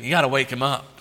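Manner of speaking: ordinary talk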